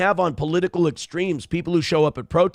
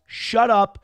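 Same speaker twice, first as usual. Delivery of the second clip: ordinary talk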